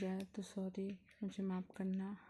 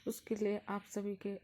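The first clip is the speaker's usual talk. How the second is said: ordinary talk, nothing marked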